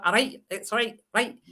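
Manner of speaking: a hint of Glaswegian, sounds pushed to the back; lightly voiced Glasgow right, right; Glasgow accent. Shown as a demonstration. Showing a Glasgow accent to the group, lightly Glaswegian. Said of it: "So a Glasgow is".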